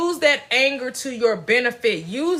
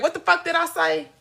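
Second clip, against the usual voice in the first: higher-pitched, exasperated scolding of oneself; stern self-talk; 7 semitones higher than usual. Acted. Speaker demonstrating how to cuss yourself out, stern self-talk. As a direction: act out telling yourself off, sharply pitched up. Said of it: "sometimes you gotta be like".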